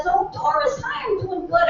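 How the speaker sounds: high, chirpy friend's voice; Edna on phone. Acted. Edna answers Doris's call, high chatty voice. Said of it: "And she says".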